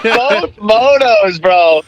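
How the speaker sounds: drawn out and loud; slow, stunned emphasis; amazed. Real, not acted. Disbelief at the race.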